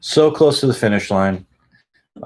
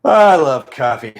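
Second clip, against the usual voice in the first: gushing over coffee; 4 semitones higher, 6 dB louder than usual. Pretend. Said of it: "It's like".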